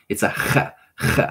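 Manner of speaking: "ch" as a rough fricative at the back of the throat, like Hanukkah; harsh throat ch. Shown as a demonstration. Teacher demonstrating the rough throat sound. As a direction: scrape "ch" hard in the throat. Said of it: "like a hard H".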